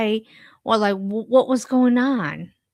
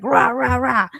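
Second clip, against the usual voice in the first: mock angry growling noises, a little louder; angry ranting; 3 semitones higher, 4 dB louder than usual. Woman showing how she gets upset at work, mock angry. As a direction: imitate your own angry ranting, lightly. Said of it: "I get angry".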